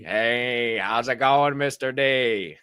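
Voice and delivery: slow, put-on voice of an older friend; imitating Mister D. Greeting Mister D in his own slow manner. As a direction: drawl the greeting like he does. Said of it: "That's how he talks".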